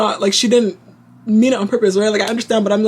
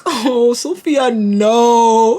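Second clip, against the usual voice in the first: dismayed groan; 2 semitones higher, 4 dB louder, much slower than usual. Retold or imitated. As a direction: imitate a slow, exasperated groan at a friend. Acted dismay as friend gets sick.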